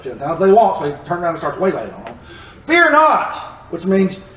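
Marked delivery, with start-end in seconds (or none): 2.6-3.3 snapped out high, a parent losing patience; impatient scolding. Retold or imitated. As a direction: scold them sharply, higher than usual. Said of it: "a very impatient tone".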